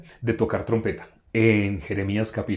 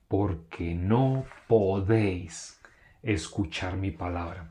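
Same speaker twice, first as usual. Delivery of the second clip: "Porque no podéis" drawn out slowly, "escuchar mi palabra" dropping low and soft; read as affirmation; 4 semitones lower, 8 dB quieter, slower than usual. Shown as a demonstration. Preacher reads the verse's answer to the congregation, slow firm affirmation. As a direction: state "Porque no podéis" slowly, let "mi palabra" sink quietly. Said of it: "Y luego hace la afirmación".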